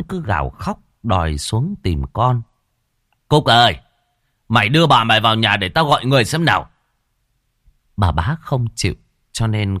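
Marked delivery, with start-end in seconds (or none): 3.3-6.7 louder, fast call to the maid. Acted. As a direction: shout across the yard, then rush. Father calls out to maid. (gọi to, nói nhanh)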